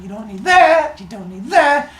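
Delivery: high, loud, careless tossing-away voice; breezy dismissal. Retold or imitated. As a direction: toss each bit aside cheerfully. Hephaestus discarding brain bits.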